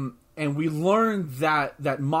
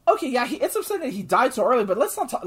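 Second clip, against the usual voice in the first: high, fast, exasperated; exasperated retort; 10 semitones higher, 4 dB louder, faster than usual. Retold or imitated. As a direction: brush off the complainers. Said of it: "I'm like".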